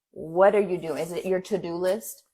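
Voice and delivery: normal talking voice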